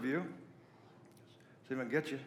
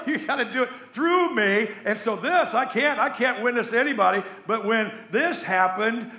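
loud, high-pitched preacher's testimony; impassioned pulpit delivery; full-force preaching; 7 semitones higher, 7 dB louder than usual. Real, not acted.

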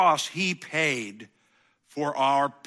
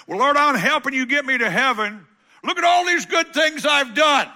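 higher, louder, shouting boast to God; mock proud plea; 11 semitones higher, 8 dB louder than usual. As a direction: shout your good deeds up to the Lord. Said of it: "I come to him and I go".

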